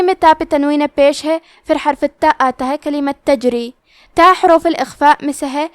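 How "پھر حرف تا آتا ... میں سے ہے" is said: ordinary talk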